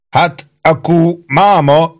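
high, drawn-out commanding voice; commanding troop boss. A narrator's reading. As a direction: address the men slowly, like a self-important sergeant. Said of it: "magyarázta embereinek Ike, parancsolóan". (parancsoló, magas, vontatott hang)

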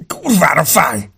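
slow, high, loud wail; agonized cry. A narrator's reading. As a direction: howl it out in pain. Wounded man crying out in pain. (elnyújtott, fájdalmas jajkiáltás)

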